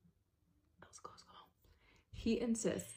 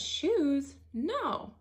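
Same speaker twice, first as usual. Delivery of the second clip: put-on a touch of a surprised girl, higher and louder; lightly voiced puzzled woman on the call; 6 semitones higher, 5 dB louder than usual. Woman on the video call denying the shoes, mild surprise. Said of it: "And she says".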